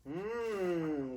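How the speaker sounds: ordinary delivery, nothing marked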